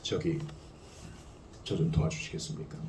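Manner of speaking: hushed, low and hesitant plea; timid polite request. Shown as a demonstration. Parent politely asking passersby to help an injured child, timid and hushed. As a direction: ask for help barely above a whisper. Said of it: "이렇게 하지 않아요".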